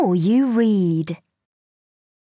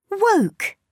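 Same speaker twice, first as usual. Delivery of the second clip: usual speaking voice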